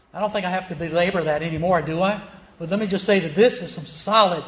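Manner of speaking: usual speaking voice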